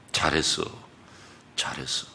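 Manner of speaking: fading to a whisper; soft praise. Retold or imitated. Pastor softly praising the father, whispered. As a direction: praise him gently, down to a whisper.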